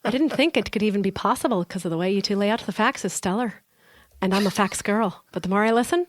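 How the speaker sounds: playful amateur Irish lilt; listener's review performed with a stage Irish accent. Pretend. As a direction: read it out in a put-on Irish accent. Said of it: "I've wanted Irish".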